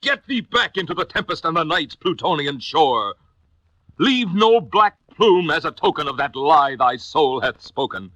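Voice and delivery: high, loud furious banishment; raging command. A narrator's reading. Narrator ordering the raven out, high loud fury. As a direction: drive the raven out of the room. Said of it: "I shrieked".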